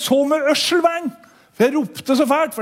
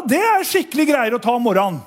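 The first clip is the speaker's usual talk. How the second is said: ordinary delivery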